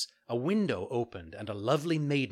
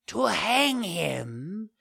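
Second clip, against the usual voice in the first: high, very slow questioning villain voice; second assassin echoes the plan, high and slow; repeating question; 9 semitones higher, 4 dB louder, much slower than usual. Acted. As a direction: echo it back, savouring. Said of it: "repeated the other".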